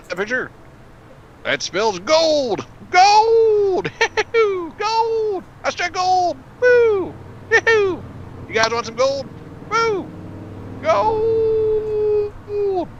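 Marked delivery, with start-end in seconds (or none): 1.4-4.8 high, yelling prospector striking gold; old-timey miner. Pretend. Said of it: "old-timey miner voice". 4.8-5.4 high prospector shout; old-timey miner. Pretend. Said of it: "old-timey miner voice". 5.6-12.9 high, yelling prospector striking gold; old-timey miner. Pretend. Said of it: "old-timey miner voice".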